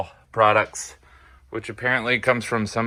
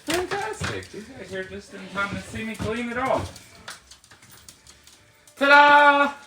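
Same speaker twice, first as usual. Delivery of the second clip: excited; 12 semitones higher than usual. Real, not acted. Showing off the finished work.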